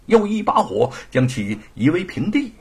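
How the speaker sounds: usual speaking voice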